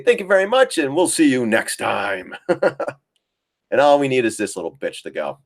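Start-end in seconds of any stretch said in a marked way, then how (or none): 0.0-2.4 high, sing-song mock goodbye; playful exit line to the market; cheeky farewell. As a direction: chirp it like leaving the trade happily. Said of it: "and say".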